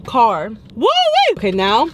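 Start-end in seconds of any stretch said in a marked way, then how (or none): 0.8-1.3 excited whoop. Real, not acted. High exclaim.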